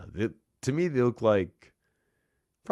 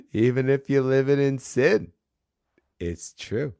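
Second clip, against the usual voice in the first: put-on Boston accent; 6 semitones higher, 7 dB louder than usual. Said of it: "get back into the accent".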